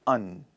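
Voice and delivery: low "un" with no final d; AND reduced to a lone "un"; unstressed and. Shown as a demonstration. Teacher has class repeat after her, unstressed low "un". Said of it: "Repeat after me".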